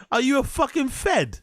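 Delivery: high, disbelieving question; incredulous. Real, not acted. Reactor questioning the storyteller's gun question, incredulous. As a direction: pitch jumps way up in disbelief.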